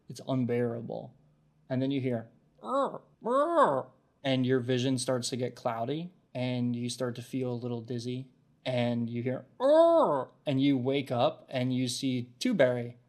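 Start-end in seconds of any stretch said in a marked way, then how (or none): 2.6-3.9 high, loud little creature cry; high critter call. Acted. Narrated critter cries. 9.6-10.3 high, loud little creature cry; high critter call. Acted. Narrated critter cries.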